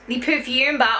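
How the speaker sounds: usual speaking voice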